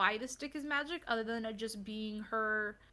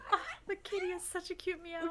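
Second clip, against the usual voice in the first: cooing; 7 semitones higher than usual. Real, not acted. Cooing over cat.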